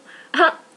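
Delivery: short loud giggle burst; sudden bright giggle; embarrassed laugh. Real, not acted. Laughs after saying te amo.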